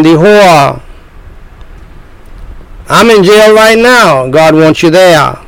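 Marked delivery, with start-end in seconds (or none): 2.9-4.3 doubting sinner voice. Pretend.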